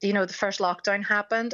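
ordinary delivery

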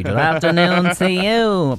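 Joe Duffy. Acted. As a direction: stretch the words like a radio host impression. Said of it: "I sound like Joe Duffy".

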